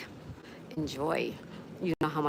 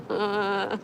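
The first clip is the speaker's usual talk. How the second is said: laughing while saying it; 4 semitones higher, slower than usual. Real, not acted. Laughing as she insists she was an angel. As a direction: barely hold back the laugh. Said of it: "I can't even say it without laughing".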